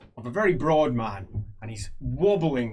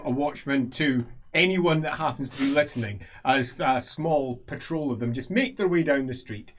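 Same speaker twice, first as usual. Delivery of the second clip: ordinary delivery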